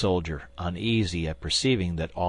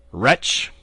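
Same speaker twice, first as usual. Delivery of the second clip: louder, scornful bark; contemptuous cry; 3 semitones higher, 6 dB louder than usual. A narrator's reading. Young guardsman scorns the assassin, contemptuous cry. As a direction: spit the insult at him. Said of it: "cried D'Artagnan".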